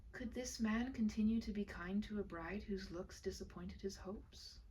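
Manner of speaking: usual speaking voice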